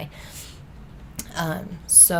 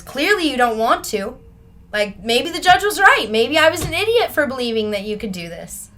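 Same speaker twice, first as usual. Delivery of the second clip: higher and louder, bitterly giving up; retold exasperated rebuke; 6 semitones higher, 8 dB louder than usual. Officer regretting he ever believed in his client, retold exasperated rebuke. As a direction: imitate a let-down officer lashing out.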